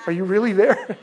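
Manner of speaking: giggling disbelief; amused mock Peter poking her; laughing. Poking the risen woman.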